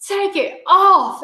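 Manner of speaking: high, slow shouted plea; kid yelling. Retold or imitated. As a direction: yell it like a panicked kid bursting in. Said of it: "Kennedy comes running ... she was like".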